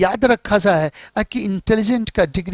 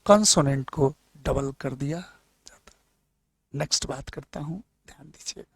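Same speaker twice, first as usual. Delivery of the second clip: trailing off into an unvoiced whisper; whispered aside; 4 semitones lower, 8 dB quieter, faster than usual. Real, not acted. Teacher trails off to the class in a whisper. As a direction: drop to a whisper at the end of the rule.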